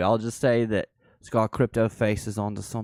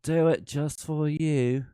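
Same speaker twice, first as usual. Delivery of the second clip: higher, slow "do it just for you"; mock British accent, lightly; 5 semitones higher than usual. Teasing chat about Missus Alder, light mock British croon. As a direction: sweet-talk in a put-on British voice. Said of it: "Missus Alder is gonna kill you".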